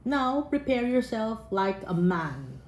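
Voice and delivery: slow, weighty reading of God's words; solemn scripture reading. Acted. Preacher reading God's challenge to Job, slow and weighty. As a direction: read it like the voice of God.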